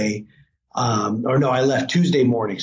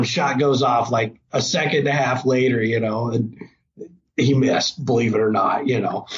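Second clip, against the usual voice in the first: normal talking voice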